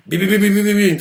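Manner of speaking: quick rattled-off bims; rapid-fire beats; fast beats. Shown as a demonstration. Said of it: "Ça va super vite".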